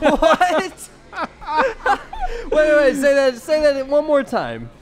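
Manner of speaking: shrieking with laughter; laughing shock. Real, not acted. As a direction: squeal in disbelief.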